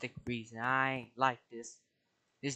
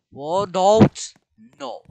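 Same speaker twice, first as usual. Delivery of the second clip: alarmed shout; 9 semitones higher, 15 dB louder, much slower than usual. Real, not acted. Object about to be flung.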